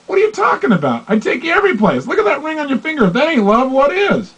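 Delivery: incredulous partner defending himself; hammed-up outraged protest; indignant disbelief. Acted indignant partner quarrel.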